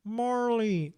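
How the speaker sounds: a hint of Owen Wilson, drawn-out Marley; lightly voiced Owen Wilson calling the dog; Owen Wilson impression. Quizmaster doing his Owen Wilson impression, lightly voiced. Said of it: "my Owen Wilson impression".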